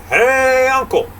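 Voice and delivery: long, high holler on HEY; drawn-out, high-pitched call. Acted. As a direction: holler to someone far away, vowel held out. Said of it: "He calls out again".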